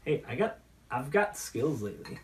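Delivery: ordinary talk, nothing marked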